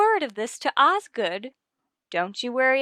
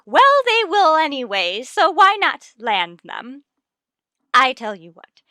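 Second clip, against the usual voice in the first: scheming encouragement; 2 semitones higher, 6 dB louder than usual. Acted. Mrs Conklin urging Miss Brooks to land the man, louder scheming push. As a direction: egg her on like a sly matchmaker. Matchmaking wife urges a scheme.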